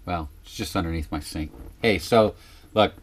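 ordinary delivery

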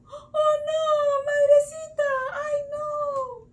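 very high, loud mock wail; acted dismay. Storyteller laments Sita's kidnapping, wailing in mock dismay. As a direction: cry out in hammed-up alarm. Laments Sita being carried off.

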